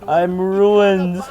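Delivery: very high, loud Italian wail; hammy Italian accent. Acted. Geno learning maps were discarded, wailing in Italian accent. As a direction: wail it in mock despair, bad Italian accent. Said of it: "try to do an Italian accent".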